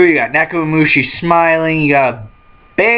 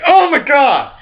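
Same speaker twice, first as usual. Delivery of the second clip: shocked disbelief; 10 semitones higher than usual. Retold or imitated. Characters voiced in shock.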